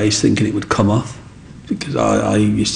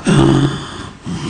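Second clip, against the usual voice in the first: gasp of amazement; 3 semitones higher than usual. Retold or imitated. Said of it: "I was like".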